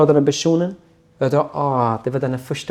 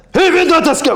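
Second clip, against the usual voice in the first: higher, louder yell; guard screaming; 7 dB louder, faster than usual. Retold or imitated. Guard screaming at the silent prisoner, yelling. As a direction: scream the question at him. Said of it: "då skriker han".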